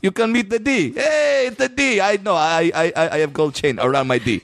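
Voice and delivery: put-on light Armenian accent, playful; lightly voiced Armenian dude accent. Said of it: "if you had an Armenian accent".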